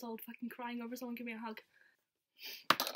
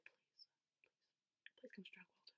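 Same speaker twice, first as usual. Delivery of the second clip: breathy pleading, much softer; pleading whisper; 2 semitones lower, 17 dB quieter than usual. Retold or imitated. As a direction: beg in a hushed voice. Begging retold in a whisper.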